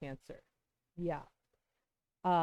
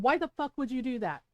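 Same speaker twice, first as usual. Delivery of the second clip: raised, louder angry retelling of a yell; indignant mock yell, higher and louder; retold yell; 5 semitones higher, 6 dB louder than usual. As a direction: re-enact yelling at someone, held short of full force. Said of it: "I yelled. I actually yelled".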